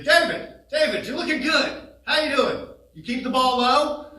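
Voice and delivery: family friend booming a hello across the room; big booming voice. Retold or imitated. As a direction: boom it out like a jovial old friend. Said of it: "an even bigger voice".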